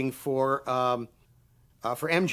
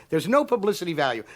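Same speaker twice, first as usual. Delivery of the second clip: ordinary delivery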